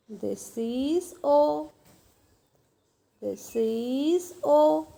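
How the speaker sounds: very slow teaching voice; long, lingering vowels; slow, drawn out. Shown as a demonstration. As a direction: stretch each word as if writing along. Teacher voicing letter while writing.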